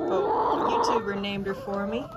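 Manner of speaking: ordinary talk, nothing marked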